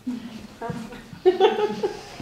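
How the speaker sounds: light laugh. Real, not acted. Laughs after "I'm inferring that.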